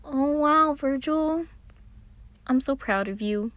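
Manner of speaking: high, loud proud gush; gushing Patton. A narrator's reading. Patton praising his brother, high and proud. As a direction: beam with pride up high.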